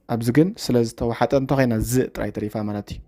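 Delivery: normal talking voice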